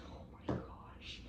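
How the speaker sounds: slow, soft whispered aside; hushed breath of wonder; awed whisper. Real, not acted. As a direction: whisper it in amazement. Awed aside on seeing halter.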